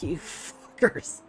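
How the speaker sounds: slow, voiceless mock curse; muttered exasperation. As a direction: curse them under your breath, drawn out. Muttering at players in retold scene.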